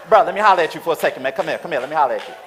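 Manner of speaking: rapid, loud bruh, let me holler; fast street hollering. Retold or imitated. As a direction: rush at him, all manners gone. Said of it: "All training out the window".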